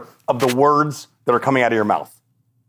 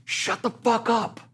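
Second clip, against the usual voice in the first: high, slow, softer put-on voice; blunt practitioner; 11 semitones higher, 5 dB quieter, slower than usual. Practitioner silencing him at the gym, slow blunt put-down. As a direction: cut him off in a lightly voiced stern coach. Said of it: "And she goes".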